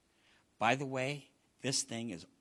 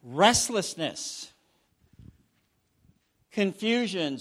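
loud, punched preacher list; a rising, forceful list; emphatic list; 8 semitones higher, 12 dB louder than usual. Acted. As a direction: hammer each word home. Lists characteristics emphatically.

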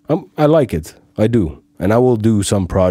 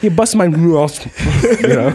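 New York accent; 8 semitones higher than usual. Shown as a demonstration. New York accent, teasing the host. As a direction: lean into the New Yorker. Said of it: "that's the New Yorker".

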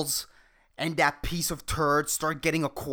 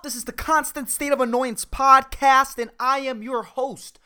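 ordinary talk

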